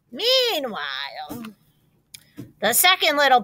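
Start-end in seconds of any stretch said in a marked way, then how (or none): none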